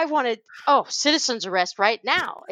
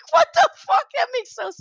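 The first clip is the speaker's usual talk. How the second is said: 1 mock outraged shout; 14 semitones higher than usual. Own reaction, shouted.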